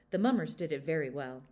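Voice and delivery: plain reading voice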